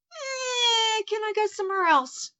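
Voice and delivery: high, whining mock complaint; reluctant high whine about leaving; whiny reluctance. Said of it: "I'm a bit like".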